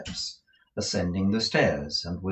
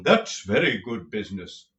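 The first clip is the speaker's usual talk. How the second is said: pompous tragedian; 7 dB louder than usual. A narrator's reading. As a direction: roll it out like a stage tragedian. Lenville's tragedian voice.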